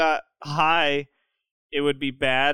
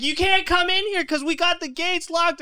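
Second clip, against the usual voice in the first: high-pitched mock yell; 14 semitones higher than usual. Girl at the locked gate refusing the cops, high mock yelling. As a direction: imitate a woman yelling in a high voice. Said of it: "some dumb bitch is out there yelling".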